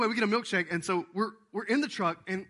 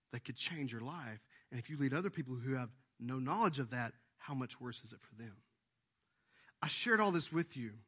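ordinary delivery

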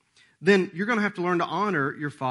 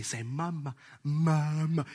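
put-on coaxing baby talk; 5 dB quieter, much slower than usual. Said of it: "She'd say".

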